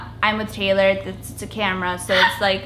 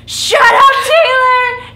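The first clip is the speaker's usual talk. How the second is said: put-on much higher and louder kid yell; sudden shout; 11 dB louder, much slower than usual. Quiet kid in carpool yelling at chatty friend, loud. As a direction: blurt it out at full volume. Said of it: "Then little quiet me goes".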